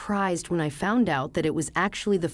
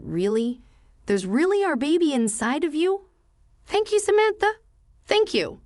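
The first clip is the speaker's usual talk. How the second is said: high, delighted disbelief rising into thanks; overjoyed; 8 semitones higher than usual. A narrator's reading. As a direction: voice leaps up high with joy at the news. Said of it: "he was overjoyed and said".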